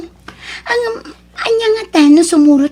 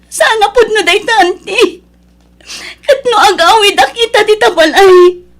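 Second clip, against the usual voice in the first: higher, louder, voice breaking in denial; disbelieving cry; 6 semitones higher, 6 dB louder than usual. Acted. Daughter hearing her father died, tearful denial. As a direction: refuse to believe it, crying.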